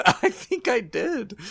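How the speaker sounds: high, slow, laughing admission; real laughing reply. Real, not acted. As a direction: say it through your own laughter. Laughing reply to stroke teasing.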